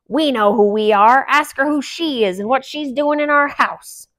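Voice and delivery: loud, scornful grouch; gruff old dwarf warning the others off; cranky dwarf ranting about females; grumpy dwarf. A narrator's reading. Grumpy voiced.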